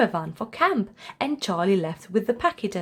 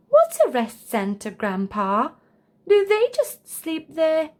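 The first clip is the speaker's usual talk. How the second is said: put-on curious child; 7 semitones higher, 3 dB louder than usual. A narrator's reading. Charlie voiced.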